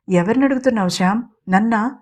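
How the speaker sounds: ordinary delivery, nothing marked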